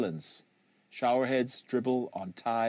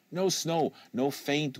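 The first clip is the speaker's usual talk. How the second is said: usual speaking voice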